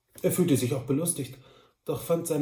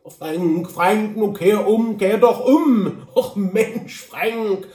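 high, drawn-out, amused shout; calling after him; 6 semitones higher, 8 dB louder, much slower than usual. A narrator's reading. Droll shouts after his cousin riding off, amused. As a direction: slowly call him back, half amused. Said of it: "schrie Droll ihm lachend nach".

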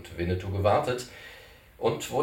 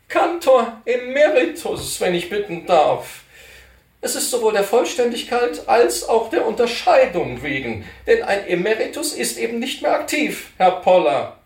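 put-on fussy old cantor; 13 semitones higher, 9 dB louder than usual. Cantor correcting Poller about his title, high and fussy. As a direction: insist on your proper title, prissily.

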